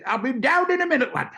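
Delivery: high, cheerful call from above. Retold or imitated. Norman calling down from the roof, cheerful and high.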